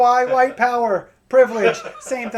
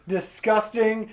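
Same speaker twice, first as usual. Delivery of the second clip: ordinary delivery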